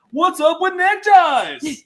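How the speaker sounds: high, loud, slow standup bit; Seinfeld impression. Host mocking a chat comment as a comedian, high and loud. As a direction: drag it out like a sitcom comic. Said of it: "I saw a lot of".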